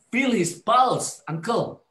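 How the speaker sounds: high, loud and slow urgent call; urgent shout. Retold or imitated. Patient urging the nurse to check the pulse, urgent shout. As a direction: push hard to get her to check. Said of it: "I think".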